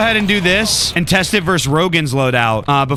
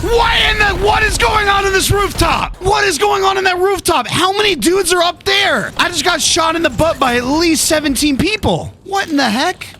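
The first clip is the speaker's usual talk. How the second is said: outraged disbelief; 12 semitones higher than usual. Real, not acted. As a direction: yell in shocked disbelief. Shot by many from rooftop.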